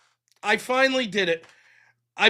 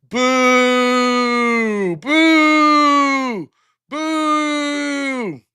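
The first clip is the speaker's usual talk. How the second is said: mock booing; 5 semitones higher, 5 dB louder, much slower than usual. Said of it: "I have to change some rules".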